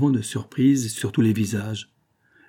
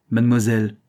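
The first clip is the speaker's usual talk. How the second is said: harsh tone; 5 dB louder than usual. A narrator's reading. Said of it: "de son ton le plus rêche".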